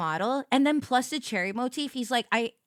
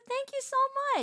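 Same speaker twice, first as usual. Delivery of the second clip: high sweet thanks. Pretend. Diner host voice.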